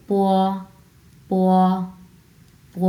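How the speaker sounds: প gliding into a long o, "pwo"; p joined with o. Shown as a demonstration. Teacher showing learners p joined with o, gliding sound. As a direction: join প smoothly to a held o. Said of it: "like this".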